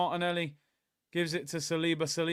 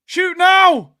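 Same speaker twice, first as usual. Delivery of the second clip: louder shout; 16 dB louder than usual. Real, not acted. Urging louder at the screen.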